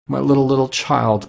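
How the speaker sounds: grieving cry. A narrator's reading. Father breaking down over his child, understated grieving cry. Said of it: "cried Bob".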